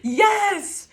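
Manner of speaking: high, loud cheer of joy; excited yes. Real, not acted. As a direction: shout it out in delight. Cheering a tarot card.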